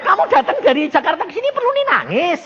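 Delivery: high, exasperated scolding; mock exasperation at a crying visitor; acted rebuke.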